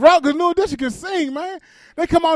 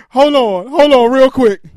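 hold on; 8 dB louder, slower than usual. Retold or imitated. Interrupts call to order food.

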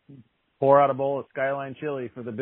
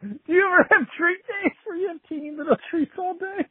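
high mock-excited. Mock-gushing question about treat days.

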